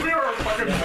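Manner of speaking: laughing. Real, not acted. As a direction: crack up mid-line. Laughs at their drunk state.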